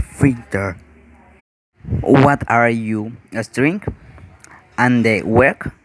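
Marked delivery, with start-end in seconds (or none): none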